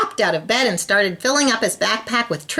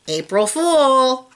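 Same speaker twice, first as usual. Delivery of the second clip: gloating; 6 semitones higher, 3 dB louder, slower than usual. A narrator's reading. Patty gloats after her trick.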